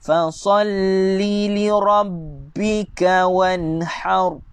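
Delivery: slow, drawn chant; measured tajwid recitation; Quran recitation. Shown as a demonstration. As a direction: recite it slowly, stretching each vowel. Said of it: "Sekali lagi saya baca".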